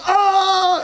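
high short yelp of fright; startled yelp. Retold or imitated. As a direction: cry out like a scared little girl. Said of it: "My daughter goes".

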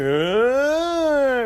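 wailing siren swooping up and down; imitated siren. Speaker showing the crowd the siren, up-and-down wail. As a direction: voice an air-raid siren, rising high then falling. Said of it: "the up and down thing".

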